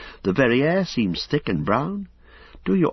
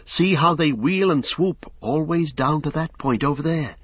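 higher, breathless panic as the riders swoop; rising terror; 6 semitones higher than usual. A narrator's reading. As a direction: voice climbing with dread. Pippin terrified watching Nazgul.

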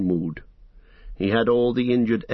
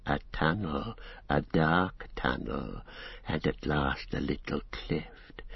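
lower, softer, slow secretive murmur; sinking lower; 6 semitones lower, 7 dB quieter, slower than usual. A narrator's reading. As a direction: draw them in with a hushed low voice. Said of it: "his voice sank even lower".

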